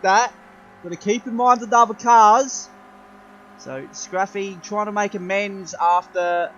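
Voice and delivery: excited race commentary; motorsport commentator. Acted. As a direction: call the race live, building up. Live race play-by-play.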